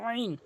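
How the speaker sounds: আইন squeezed from mid-throat; pressed throat sound. Shown as a demonstration. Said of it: "হলকের মাঝখান থেকে চাপ দিয়ে". (আইন হলকের মাঝখান থেকে চাপ দিয়ে)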